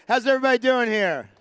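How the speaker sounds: rally the room; loud hype question to the crowd; calling out to crowd. Real, not acted. Host rallying the live crowd.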